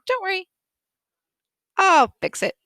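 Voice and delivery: put-on high, bright-pitched mom soothing her child; reassuring mom. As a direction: raise the pitch and jump in to reassure.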